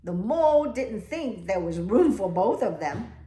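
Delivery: storybook reading. A narrator's reading. Teacher reading the class a picture book, lively. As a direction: tell it with bounce.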